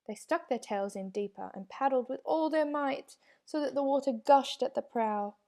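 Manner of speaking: plain reading voice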